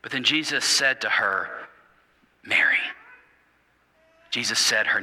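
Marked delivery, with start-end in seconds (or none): 2.4-2.8 a tender whisper of her name. Acted. Jesus speaks her name gently.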